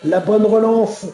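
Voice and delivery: lively live play-by-play; motorsport commentator. Real, not acted. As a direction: call the race as it happens. (commentateur de course automobile)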